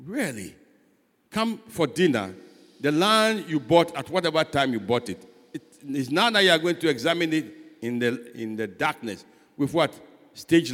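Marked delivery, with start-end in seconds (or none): none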